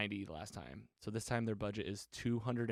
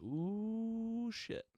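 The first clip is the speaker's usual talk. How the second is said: mock surprise; 11 semitones higher than usual. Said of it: "And it's like".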